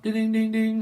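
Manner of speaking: high, loud sung-out ding-ding-ding; imitated bell. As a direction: mimic an insistent service bell. Imitating someone ringing a service bell.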